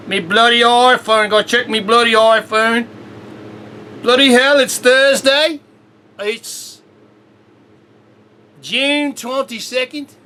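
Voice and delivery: a hammed-up Aussie British voice, not fully convincing; mock Aussie British accent. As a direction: play a half-hearted Aussie British bloke checking his phone. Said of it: "as a Aussie British person".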